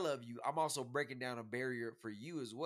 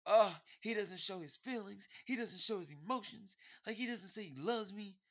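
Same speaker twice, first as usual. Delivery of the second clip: higher-pitched imitation of a girlfriend's complaint; mocking complaining girlfriend; 8 semitones higher than usual. Boyfriend imitating women who say men hide feelings, lightly mocking. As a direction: lightly mimic her whining about him.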